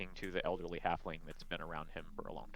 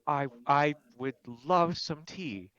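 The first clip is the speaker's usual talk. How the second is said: higher, slow polite voice; polite halfling voice; 8 semitones higher, 8 dB louder, slower than usual. Acted. Glamdry accepting tea from River, slowly. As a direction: accept graciously.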